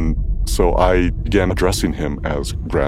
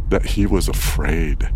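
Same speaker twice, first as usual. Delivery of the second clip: usual speaking voice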